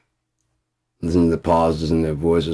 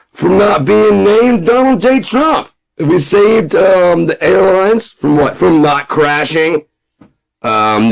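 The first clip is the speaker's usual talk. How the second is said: much higher, louder blustering Trump, slow on names; Trump caricature; 9 semitones higher, 7 dB louder than usual. Pretend. Comic Trump brushing off reporters and voters, blustering high. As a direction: bluster like a boastful president.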